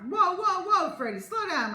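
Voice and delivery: high, loud whoa; urgent. Acted. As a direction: rein him in loudly. Mom stopping boy eating fast.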